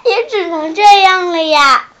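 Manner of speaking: ordinary delivery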